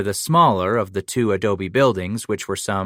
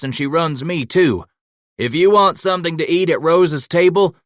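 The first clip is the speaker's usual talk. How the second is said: higher and louder bossy shout; hearty calling out; 6 semitones higher, 5 dB louder than usual. A narrator's reading. Mateo calling the boys to wash up for supper. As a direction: herd the boys toward supper.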